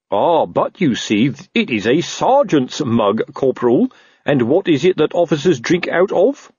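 slow, officious explaining; condescending officer spelling it out; pedantic captain. Acted. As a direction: lead the underling to the point, drawn out. Narrator voices pedantic Colon.

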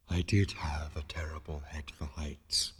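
low, slow, hushed ghostly regret; wistful. A narrator's reading. Disembodied voice recalling its lost head, wistful. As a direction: sigh it out with stress on did. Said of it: "it added wistfully".

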